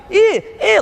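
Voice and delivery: high, loud, expansive yelp; wild outburst. Shown as a demonstration. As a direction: burst out with crazy energy. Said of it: "like that, it".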